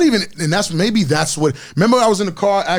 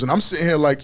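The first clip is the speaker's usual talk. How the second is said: normal talking voice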